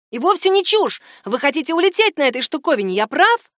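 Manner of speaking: loud, high triumphant challenge; excited insistence. A narrator's reading. Franz insists on his discovery.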